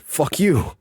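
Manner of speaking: high, loud put-on jeer; mock insult. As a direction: fling it like a playground taunt.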